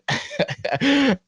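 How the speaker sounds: very high giggle; laugh. Real, not acted. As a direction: giggle as the memory comes back. Laughing before the story.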